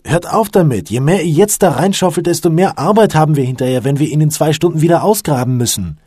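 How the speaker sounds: high, loud remonstration; exasperated shouted argument; calling out in protest. A narrator's reading. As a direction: raise the voice to make them stop. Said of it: "rief Luke".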